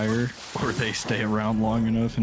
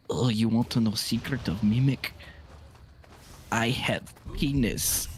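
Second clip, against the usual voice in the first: put-on Russian accent; 2 semitones higher than usual. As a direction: drag out the last words in a heavy Russian accent. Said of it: "the thickest Russian accent I've ever heard".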